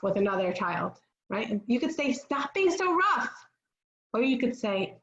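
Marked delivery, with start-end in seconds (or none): 2.1-3.3 high-pitched, drawn-out parental reprimand; mock scolding. Parent to a child being rough with another, mock scolding. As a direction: tell off the child in an acted voice. Models scolding, against gentler wording.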